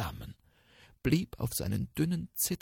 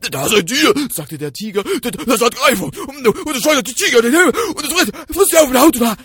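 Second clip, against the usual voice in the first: higher, loud, fast frightened babbling; panicked tiger; 12 semitones higher, 16 dB louder, much faster than usual. Acted. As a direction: stammer out the horror. (stammelnder, panischer Tiger)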